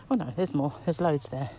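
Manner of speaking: ordinary delivery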